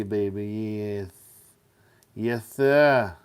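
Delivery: playful, lisping funky put-on voice; goofy jive-talk patter. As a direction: riff in a silly funky groove, stretching the rhymes. Playful riff on the word funky.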